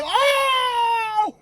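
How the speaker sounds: very high, drawn-out comic wail; hammed-up frantic howl; losing his mind. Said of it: "Buggy is fucking losing his fucking mind".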